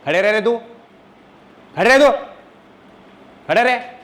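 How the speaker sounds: high, forceful reprimand; real angry scolding. Real, not acted. Teacher making a misbehaving pupil stand, angry scolding. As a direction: order a pupil about in anger.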